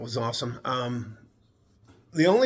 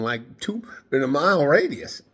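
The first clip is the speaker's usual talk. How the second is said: ordinary talk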